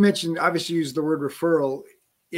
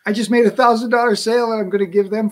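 mock outrage; 6 semitones higher, 6 dB louder, faster than usual. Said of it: "that sounds like a ton".

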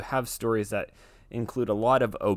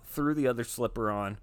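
normal talking voice

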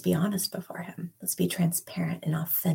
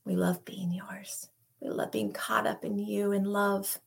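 whispered prayer. Acted.

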